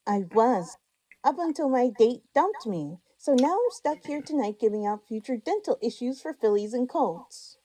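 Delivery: a little haughty about being dumped; hint of arrogance. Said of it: "a hint of arrogance staining her tone".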